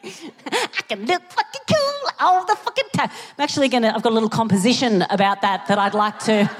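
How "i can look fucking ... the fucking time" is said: much higher, mock-swaggering declaration; hammed-up vow. Shown as a demonstration. To the crowd, replaying the brag in a camp swagger. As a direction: belt out the brag again, camper and higher. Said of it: "I don't know why I said it like that".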